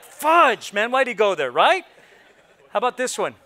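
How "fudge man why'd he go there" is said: mock exclaim. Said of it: "You're like".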